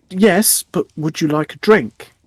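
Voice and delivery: high-pitched, put-upon little innkeeper; sighing gnome innkeeper. Acted. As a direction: play the gnome high, after an audible sigh. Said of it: "He sighs, almost, uh, entirely audibly".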